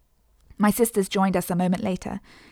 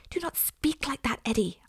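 sharp voiceless whisper, higher pitch; hissed whisper; 6 semitones higher, 4 dB quieter than usual. A narrator's reading. Eliza hushes her little brother in church, hissing. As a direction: scold him under your breath. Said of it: "I hissed".

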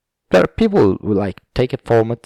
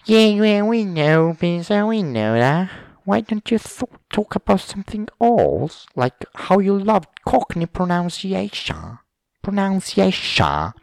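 high, loud, whiny-mock listener voice; mocking heckler; 10 semitones higher, 3 dB louder than usual. Bored listener cutting off the podcaster, high mocking heckle.

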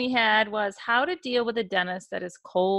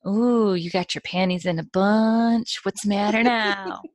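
playful, louder needling; teasing mock concern; 5 dB louder than usual. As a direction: stretch it out to tease a sulker. Retells teasing her sulking boss.

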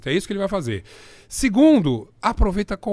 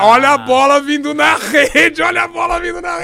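sports announcer call; 9 semitones higher, 7 dB louder than usual. Pretend. Joking about [name]'s flirt, stretched announcer shout. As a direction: belt it like a TV goal call.

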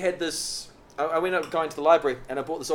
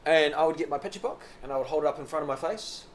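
ordinary talk, nothing marked

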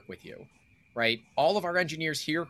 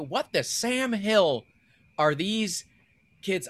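folksy exclamation; 6 semitones higher, slower than usual. Pretend. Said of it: "I should have done that in Hank Hill".

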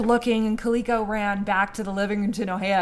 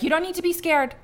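reassuring; 6 semitones higher, 5 dB louder, faster than usual. Retold or imitated. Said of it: "I got a little chaotic 'cause Kaliko was like".

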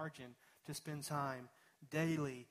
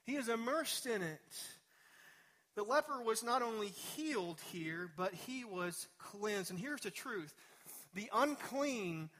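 rising, higher and louder sermon climb; full-force preaching; 7 semitones higher, 4 dB louder than usual. Acted. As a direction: preach it with growing fervour.

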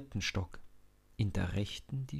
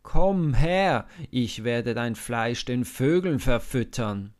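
threatening shout; 2 semitones higher, 9 dB louder than usual. Acted. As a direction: bellow the threat. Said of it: "ruft er".

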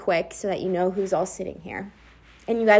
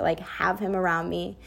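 ordinary talk, nothing marked

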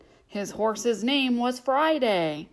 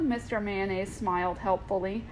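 ordinary delivery, nothing marked

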